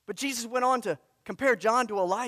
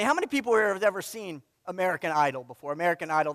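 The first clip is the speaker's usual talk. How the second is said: normal talking voice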